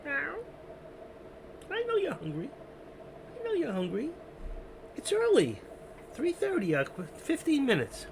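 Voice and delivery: high, sing-song voice for a pet; cooing at the cat. Real, not acted. Owner telling his hungry cat to wait, high cooing. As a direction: coo up high like you're soothing a hungry kitty.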